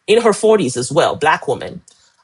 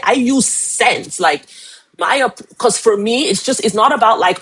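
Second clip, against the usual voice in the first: ordinary talk, nothing marked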